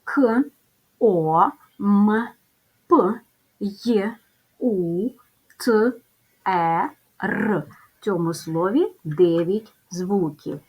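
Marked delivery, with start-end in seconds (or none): 0.0-7.6 К, О, М, П, Й, У, Т, Е, Р said one by one, with pauses; sounding out phonemes. Shown as a demonstration. Teacher counts the sounds aloud with the class, one by one. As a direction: sound out each letter of К, О, М, П, Й, У, Т, Е, Р separately. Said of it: "Порахуємо звуки".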